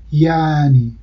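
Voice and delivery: یعنی as a slow yaaani, no glottal stop; lengthened vowel. Shown as a demonstration. Linguist demonstrating the dropped ain, lengthened vowel. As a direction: stretch the first vowel of یعنی.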